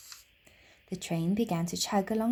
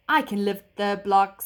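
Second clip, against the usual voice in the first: robot voice; 8 dB louder than usual. A narrator's reading. Tin robot voice.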